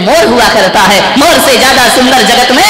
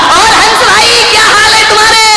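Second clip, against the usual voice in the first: crow calling out; 10 semitones higher than usual. Acted. Crow hails the swan.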